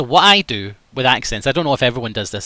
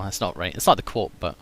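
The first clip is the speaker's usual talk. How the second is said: ordinary delivery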